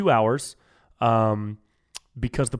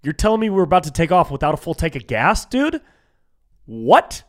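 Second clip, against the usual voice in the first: incredulous; 4 semitones higher, 5 dB louder than usual. Retold or imitated. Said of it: "I was like".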